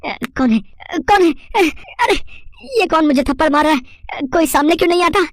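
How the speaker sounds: high, startled yelps of alarm; panicked thief. Acted. Thief slapped by someone invisible, high-pitched panic. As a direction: squeal in fright at an unseen attacker.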